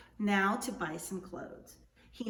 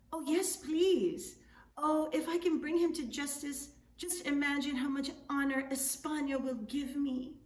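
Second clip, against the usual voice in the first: delighted governor; 6 semitones higher, 4 dB louder than usual. Acted. Governor dreaming of honor from Spain, overjoyed and high. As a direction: gush with hopes of glory.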